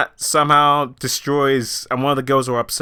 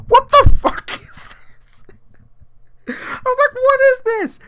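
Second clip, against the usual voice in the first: high, loud, slow stunned cry; shocked disbelief; 4 dB louder than usual. Retold or imitated. Reacting to twerking scene.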